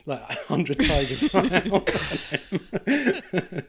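laughing through the words; giggling. Real, not acted. Laughing while telling.

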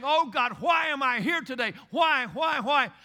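loud, anguished pleading why; desperate cry to God. Retold or imitated. A believer crying out to God in anguish, loud plea.

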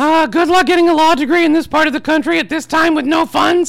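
high, loud, pompous gentleman voice; grand self-important literary heir; Christopher Tolkien voice. Acted. Holds the Tolkien character voice.

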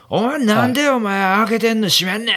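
high, heated mock argument; heated yelling. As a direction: retell the shouting match with rising heat. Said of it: "みたいな感じのトーンになってき".

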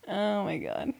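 ordinary delivery

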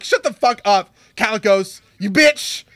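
shouted high insult; mock shout. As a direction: yell it with mock rage. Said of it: "I'm just like".

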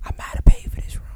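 whispered aside. Real, not acted. Confidential aside amid group argument.

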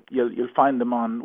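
ordinary talk

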